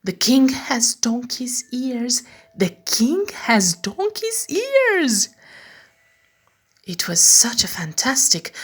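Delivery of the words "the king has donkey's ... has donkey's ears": high, slow gleeful repetition; bursting with a secret. Acted. Meg in bed, unable to stop thinking, slow gleeful chant. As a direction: savour the secret, climbing higher.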